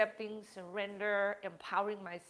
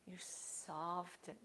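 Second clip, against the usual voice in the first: You soften drawn out, slow and hushed; slow soft emphasis; 2 semitones lower, 9 dB quieter, much slower than usual. Shown as a demonstration. Yoga teacher stressing softening to the class, slow and hushed. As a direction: let "You soften" melt away slowly. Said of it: "That's important".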